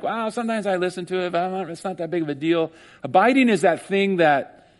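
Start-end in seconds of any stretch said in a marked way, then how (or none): 0.0-2.7 fast, softer offhand voice; mock casual listener. Preacher mocks a casual believer, fast and softer. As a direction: shrug it off. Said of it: "it's not like".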